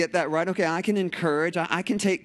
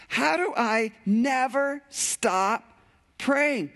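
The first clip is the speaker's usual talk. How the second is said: high, slow disbelief, drawing out never; baffled listener pushing back, much higher; incredulous; 7 semitones higher, much slower than usual. Retold or imitated. Pastor voicing a doubting congregation, incredulous. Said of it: "anybody else going".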